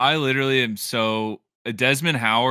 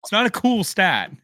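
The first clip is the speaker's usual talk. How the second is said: higher, louder objection; loud protest; 9 semitones higher, 5 dB louder than usual. Real, not acted. Fan objecting to friend's stat, loud protest.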